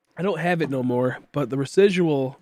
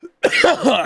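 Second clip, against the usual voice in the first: sudden sharp cough, pitched up; high hacking cough; 13 semitones higher, 9 dB louder than usual. Real, not acted. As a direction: hack out a real cough. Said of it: "Sorry, I'm coughing".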